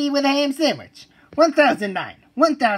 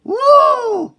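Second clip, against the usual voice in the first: wild whoop; 13 semitones higher, 8 dB louder than usual. Acted. Wild ride cheer.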